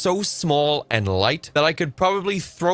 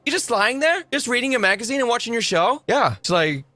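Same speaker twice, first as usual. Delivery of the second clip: indignant mock rant; 5 semitones higher than usual. Streamer playing the tired mechanic, indignant and high. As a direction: fire back at her, high and annoyed. Streamer plays man answering nagging partner.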